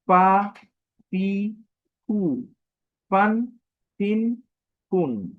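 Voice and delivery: পা পি কু said loud and separate; vowel drill. Shown as a demonstration. Teacher drilling vowel forms with students. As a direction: chant each vowel form. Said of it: "বিভিন্ন উচ্চারণটা হবে এরকম".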